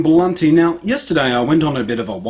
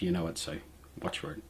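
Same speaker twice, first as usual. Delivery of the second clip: dropping to a hush; 8 semitones lower, 19 dB quieter, faster than usual. Real, not acted. Teasing viewers about comments.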